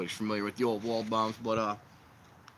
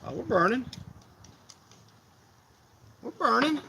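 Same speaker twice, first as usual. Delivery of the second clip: higher, delighted we're burning; excited; 11 semitones higher, 4 dB louder than usual. Real, not acted. Streamer thrilled his fire pit lit, high excited cheer. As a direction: cheer as the flame catches.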